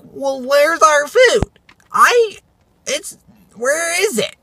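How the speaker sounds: high, dragged-out mocking demand; put-on high teenage complaint; impatient teen girls. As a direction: mimic whiny teens demanding service. Mimics impatient teen customers demanding food.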